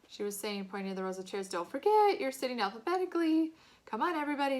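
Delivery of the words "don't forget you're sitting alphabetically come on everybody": teacher calling out. A narrator's reading. Teacher calling class to order.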